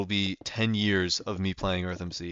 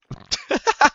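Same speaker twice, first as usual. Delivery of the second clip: loud, high burst of laughter; 7 dB louder than usual. Real, not acted. Streamer laughing at his own inner voices, high cackle. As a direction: crack up in a high-pitched cackle.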